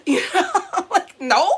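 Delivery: cracking up, loud and lifted; laughing. Real, not acted. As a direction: laugh in disbelief at your own story. Laughing at own story.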